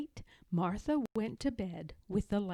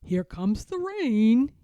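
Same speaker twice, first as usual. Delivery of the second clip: higher, louder mischievous shout; gleeful cry; 4 semitones higher, 9 dB louder than usual. Acted. George soaks Martha as a prank, gleeful shout. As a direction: call it out full of mischief. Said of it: "he cried".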